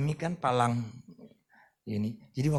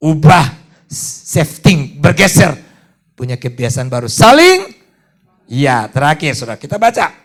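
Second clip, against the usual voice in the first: full-force preaching; 7 semitones higher, 18 dB louder than usual. Acted. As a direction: proclaim it to the whole church.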